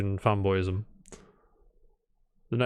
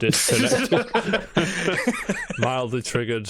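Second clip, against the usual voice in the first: laughing; 8 semitones higher, slower than usual. Real, not acted. Repeating guest's phrase, amused.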